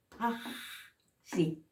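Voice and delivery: PAXI with a very long hissed breath before si; aspirated J. Shown as a demonstration. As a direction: hold the airy throat x of PAXI. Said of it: "el signo expirado".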